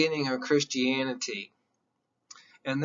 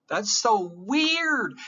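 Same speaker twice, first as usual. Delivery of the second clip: higher, slow and drawn out; amazed; 7 semitones higher, much slower than usual. Real, not acted. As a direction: savour the strangeness. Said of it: "Tell me that's not weird".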